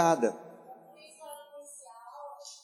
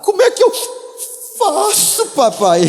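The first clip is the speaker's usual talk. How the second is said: put-on much higher, pleading child voice; mock little girl; 14 semitones higher, 4 dB louder than usual. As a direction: ask like a small girl to her daddy. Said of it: "ela ia falar".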